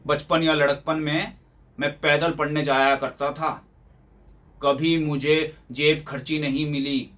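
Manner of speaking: slow, measured imitation of father's set speech; reminiscing father. Son imitating father's much-repeated tale of childhood hardship, slow recital. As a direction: recite it slowly, like an oft-repeated lecture. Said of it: "अरे, हजारों बार तो एक बात कह चुके हैं".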